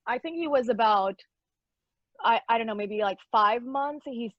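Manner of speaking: usual speaking voice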